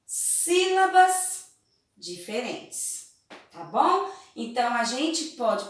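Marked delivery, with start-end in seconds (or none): 0.1-1.5 "sílabas" drawn out high and loud; emphasized word. Shown as a demonstration. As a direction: lean hard on "sílabas", slowly. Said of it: "nós vamos começar a ver". ("sílabas" alongado, agudo e forte)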